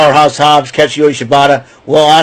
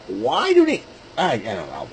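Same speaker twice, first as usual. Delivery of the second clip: mock exchange; 3 semitones lower, 9 dB quieter than usual. Vlogger mocking the Omega fans with a question, then a low mumble. Self-dialogue question and answer.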